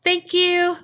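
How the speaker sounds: high, fast called-out plea; hammed-up high shout to the room; retold yell. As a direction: yell it up through the door, mock urgent. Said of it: "He just kind of yells".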